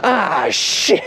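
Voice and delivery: high-pitched mock dismay; dismayed dad. Dad learning the wheel was down, high retold dismay. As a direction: imitate dad realizing his blunder. Dad realizing his mistake.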